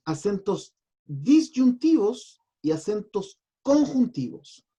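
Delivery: DISYUNTIVOS and CONJUNTIVOS hit hard, slowly, with pauses before them; emphatic key terms. Shown as a demonstration. Teacher dividing accents into two groups, emphatic key terms. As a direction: set "disyuntivos" against "conjuntivos", slow and punched.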